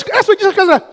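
ordinary talk, nothing marked

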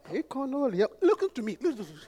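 hushed, low mock church voice; imitating Orthodox churches. Preacher imitating restrained church prayer, hushed and low. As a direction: murmur it very softly and low. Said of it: "some of Orthodox churches".

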